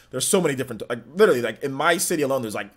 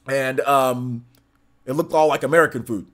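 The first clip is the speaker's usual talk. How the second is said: ordinary delivery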